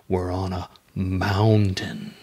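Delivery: low, drawn-out answer; slow deadpan punchline. Acted. Comic answering own question, slow and deadpan. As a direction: drop low and stretch out mountain.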